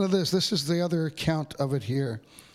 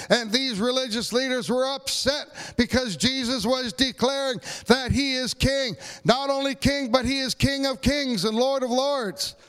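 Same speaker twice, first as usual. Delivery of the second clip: preaching higher, building toward a proclamation; rising preaching; 8 semitones higher than usual. Acted. Pastor proclaiming Jesus as King of Kings to the congregation, rising preaching. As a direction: build it up like a climax from the pulpit.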